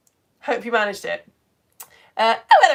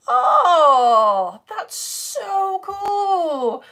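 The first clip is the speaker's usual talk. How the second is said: excited; 9 semitones higher, 8 dB louder, much slower than usual. Real, not acted. Reacting to a viewer's message.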